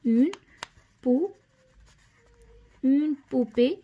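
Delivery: "اون", a long pause, then "بو" alone; broken-up dictation. Shown as a demonstration. Teacher writing the word for pupils, piece-by-piece "اون بو".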